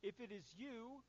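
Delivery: lightly voiced Peter; eager Peter. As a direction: call out to the Lord eagerly. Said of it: "Peter pipes up and says".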